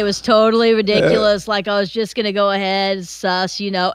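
put-on Californian dude accent; Californian bro accent. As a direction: imitate a California bro trailing along. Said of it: "like, in L A".

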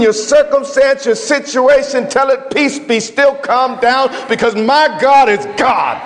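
rising sermon cadence; preaching at full force. Acted. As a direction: lift the congregation with fervour. Preacher building to a climax.

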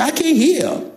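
imitated wife. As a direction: lift the pitch to quote a wife. Wife's complaint about his low talk.

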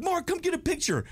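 high-pitched imitation of an eager fan; fan calling out. Fans calling player over for a picture, high mock excitement.

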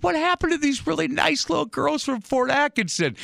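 high, put-upon whine; hammed-up falsetto complaint; mock whine. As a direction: squeak out hurt disbelief. Mock outrage at the girls.

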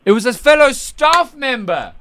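loud, high-pitched dramatic revelation; mock-shocked exclamation. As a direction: blurt out the scandal in played-up shock. Host reacts to the revelation.

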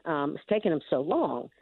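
usual speaking voice

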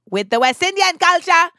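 high, loud put-on West Indian accent; playful West Indian accent. Celebrates culture in put-on island voice.

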